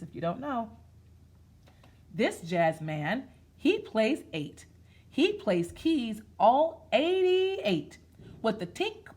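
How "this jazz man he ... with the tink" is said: slow, sing-song rhythmic reading; read-aloud rhyme. Acted. Teacher reading a jazz picture book to kids, in a slow sing-song. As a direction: chant it like a nursery rhyme, slow.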